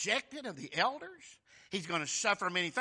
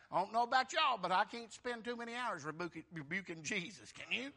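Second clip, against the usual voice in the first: ordinary talk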